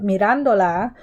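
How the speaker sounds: mirándola with RÁN stressed and rising; stress on the middle A. Shown as a demonstration. Teacher shows class where mirándola is stressed. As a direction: lean on the A in mirándola. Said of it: "putting the stress here on this middle A".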